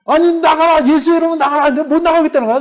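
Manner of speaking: high-pitched, insistent retelling of a struggle; exasperated exorcism. Retold or imitated. Preacher retelling a failing exorcism, exasperated. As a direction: push the words out high, fading at the end. Struggling to cast out stubborn demon.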